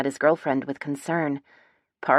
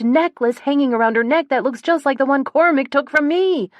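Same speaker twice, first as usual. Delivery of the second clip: high, run-on outpouring, growing louder; mounting realization; 8 semitones higher, 5 dB louder than usual. A narrator's reading. Sister realizes who Gemma is, mounting shock. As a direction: build breathlessly from shock to loud outcry.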